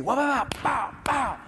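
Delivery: imitating gunfire. Shown as a demonstration. Imitating rounds flying by.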